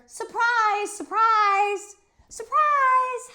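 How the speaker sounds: high, loud shouts; hollering girl. Acted. Best friend Grace shouts surprise over and over. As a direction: Grace yelling with glee. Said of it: "hollered my bestest friend named Grace".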